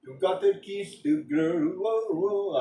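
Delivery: sung in a failed Jamaican accent; bad Jamaican accent. Shown as a demonstration. Trying the song's line for fun, bad Jamaican accent. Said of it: "cannot do a Jamaican accent".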